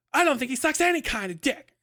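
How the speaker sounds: roasting impression of a camp drunk friend; over-the-top camp impression. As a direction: ham up the drunk friend's retort. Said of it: "roasting him with that impression".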